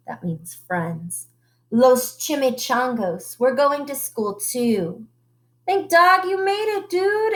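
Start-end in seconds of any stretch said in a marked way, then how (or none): 5.7-7.3 excited exclaim. Acted. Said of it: "exclaimed Don Diego".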